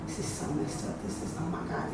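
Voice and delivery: normal talking voice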